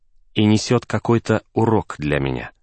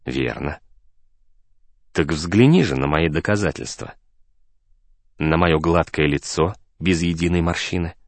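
lightly voiced serene man, pitched low; a hint of a composed mentor, in a lower voice; calm, assured friend; 5 semitones lower than usual. A narrator's reading. Narrator voices Julian, calm and assured. (слегка обозначенный спокойный, уверенный друг, голос пониже)

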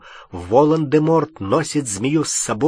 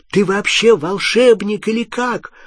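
high-pitched, incredulous scolding; exasperated challenge; 8 semitones higher, 4 dB louder than usual. A narrator's reading. Hermione scolds Ron for forgetting his magic, exasperated challenge. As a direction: needle him with a raised, indignant question.

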